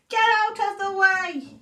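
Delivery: high, loud yell; sheep yells. Acted. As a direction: bellow at the duck from the jeep. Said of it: "he yells with a beep".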